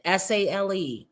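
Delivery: "S A L E" spelled letter by letter, each letter separate. Shown as a demonstration. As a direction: spell out "S A L E" like a lesson. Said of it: "They sell it".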